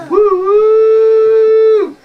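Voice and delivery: long, loud, high train hoot; train whistle. Acted. Feeding kitty with a train game, loud whistle hoot. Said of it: "here comes a choo-choo train".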